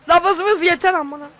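annoyed shout. Real, not acted. Annoyed at buzzing.

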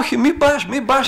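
high, loud begging; cling to her and beg her not to go; desperate pleading cry. Retold or imitated. Possessed woman begging her friend not to confess, in a high loud desperate cry.

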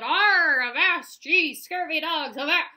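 loud, roaring pirate growl; bellowing pirate. A narrator's reading. Toy pirate bellows at the crew, roaring. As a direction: bellow it like a sea dog. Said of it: "egg-shaped pirate with that bellowed".

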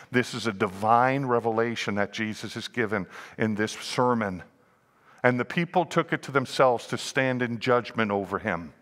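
ordinary delivery

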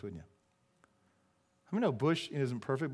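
normal talking voice

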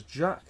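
Jacques, French zh and open ah; French pronunciation. Shown as a demonstration. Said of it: "French pronunciation of his name".

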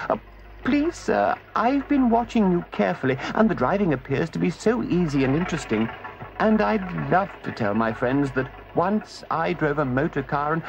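high, wheedling put-on voice; pleading Toad. Toad in disguise begs the driver for a go, wheedling. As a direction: coax the driver sweetly.

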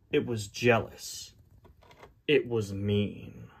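slow, grave storybook reading with long pauses; measured, dramatic story narrator; dramatic storybook narration. A narrator's reading. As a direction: narrate it like a solemn tale, unhurried. Reads the storybook aloud.

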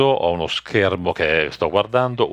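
normal talking voice

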